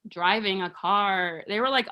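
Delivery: ordinary talk, nothing marked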